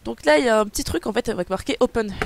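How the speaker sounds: ordinary delivery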